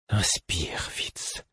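breathy whispered command; whispered order. A narrator's reading. Fool ordering his patient to breathe, whispered. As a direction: whisper a firm instruction. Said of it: "m'ordonna le fou".

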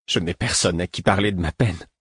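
usual speaking voice

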